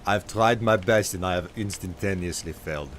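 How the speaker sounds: put-on Nord accent slipping into Schwarzenegger; failed Nord accent. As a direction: try a Nord accent, sounding accidentally Austrian. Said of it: "a Nord accent".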